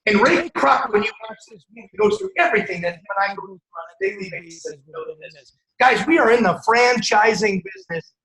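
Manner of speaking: loud, driving motivational preacher; preaching at full force. Acted. Rallying the crowd about the McDonald's movie, loud preaching. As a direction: shout it out to the whole room like a revival.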